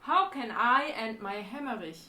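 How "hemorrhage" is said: "hemorrhage" pronounced as if it were German; German-style "hemorrhage"; German-sounding pronunciation. Real, not acted. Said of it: "making it German sounding".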